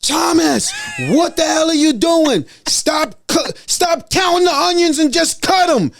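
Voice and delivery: high mock shout at a slow cook; imitating screaming chef. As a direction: yell it like a chef out of patience. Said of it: "screaming at me".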